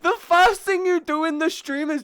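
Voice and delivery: high loud outraged after a scare; scared horror game streamer. Real, not acted. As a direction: shriek at the game that scared you. Complaining after jump scare.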